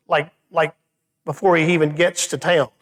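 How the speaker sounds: normal talking voice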